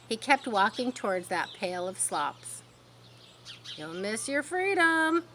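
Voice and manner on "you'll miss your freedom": high, slow honk; honking goose. A narrator's reading. Goose honking at Wilbur, high and slow. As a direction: drag out the warning. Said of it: "honked the goose".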